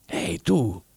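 hushed aside to oneself; said to himself. A narrator's reading. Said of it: "disse fra sé Ivan Jakovlevich". (detto piano fra sé)

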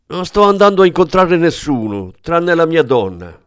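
high, loud desperate shout; shouting detective. Acted. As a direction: yell it to break free. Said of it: "gridò il detective". (grida forte e acuto, disperato)

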